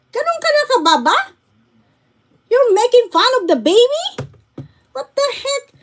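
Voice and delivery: aghast at what he is watching; outraged disbelief. Real, not acted. Vlogger scolding a streamer who laughed at a baby, outraged disbelief.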